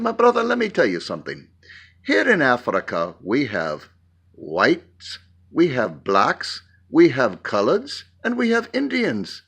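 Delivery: put-on lightly done African accent, heritage-proud; African pastor accent. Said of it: "Do you like my accent".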